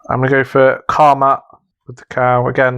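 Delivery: ordinary talk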